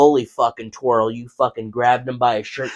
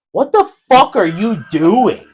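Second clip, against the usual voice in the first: loud, slow, drawn-out indignant question; mock-outraged retold challenge; retold indignation; 8 semitones higher, 6 dB louder than usual. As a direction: demand an explanation, raised and slow. Retold reaction to being punched.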